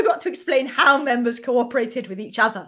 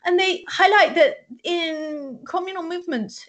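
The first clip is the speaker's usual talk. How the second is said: ordinary delivery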